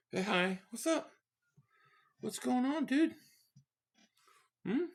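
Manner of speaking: high, soft pet-talk voice; talking to cat. Real, not acted. Streamer greeting his just-woken cat Tank, high and soft. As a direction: coo up at the kitty softly.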